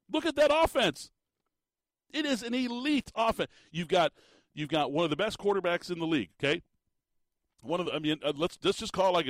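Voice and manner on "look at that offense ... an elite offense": raised, excited pitch for the offense. Real, not acted. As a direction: sell it with big energy. Host hyping elite offense.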